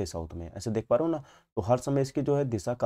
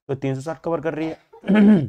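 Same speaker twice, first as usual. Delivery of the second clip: slow, loud and higher; slow loud stress on the answer; emphatic conclusion; 5 semitones higher, 8 dB louder, slower than usual. Real, not acted. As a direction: land the point firmly.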